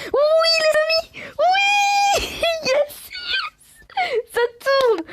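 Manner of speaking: very high, drawn-out shrieks of triumph; real ecstatic screaming. Real, not acted. Gamer celebrating with viewers after a countdown opening, long ecstatic screams.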